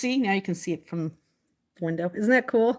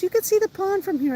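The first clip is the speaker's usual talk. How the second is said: imitating mom; 11 semitones higher, 3 dB louder than usual. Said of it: "my mom goes".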